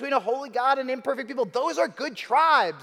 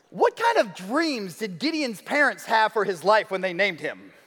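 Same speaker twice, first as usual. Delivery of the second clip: ordinary talk, nothing marked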